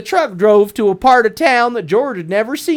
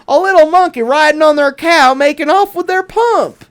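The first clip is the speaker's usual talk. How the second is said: higher and louder exclaim; storyteller's surprised exclamation; surprised reveal; 6 semitones higher, 5 dB louder than usual. A narrator's reading. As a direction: announce the shocking sight. Farmers' surprising discovery.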